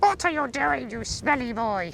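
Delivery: very high, silly pet-talk voice; high-pitched scolding. Real, not acted. To the smelly boy rolling about, high-pitched scolding. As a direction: squeal a playful telling-off.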